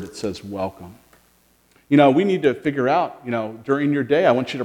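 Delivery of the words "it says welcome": hushed. Shown as a demonstration. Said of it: "big, big word".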